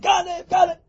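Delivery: high, drawn cry of alarm; mock screaming. Waking the street about a thief, mock screaming. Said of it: "start screaming in the middle of the street".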